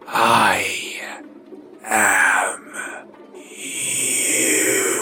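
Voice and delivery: shrill hissing whisper. Acted. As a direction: hiss it like a snake. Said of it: "In a hissing voice".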